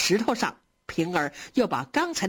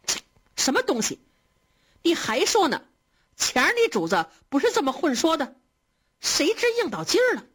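high-pitched, indignant scolding with a scornful 'tch'; indignant retort; 7 semitones higher than usual. A narrator's reading. Maid rebukes her friend for crude teasing, indignant. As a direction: spit back in offended anger, pitch jumping up. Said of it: "鸳鸯气得啐了一口".

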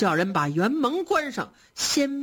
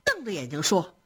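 usual speaking voice

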